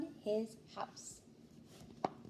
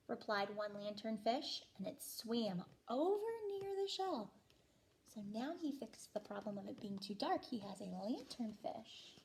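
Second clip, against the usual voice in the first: plain reading voice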